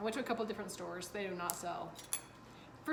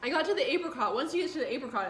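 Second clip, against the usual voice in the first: mouth full; 4 semitones higher, 9 dB louder, faster than usual. Real, not acted. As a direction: still chewing while talking. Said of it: "I'm still chewing".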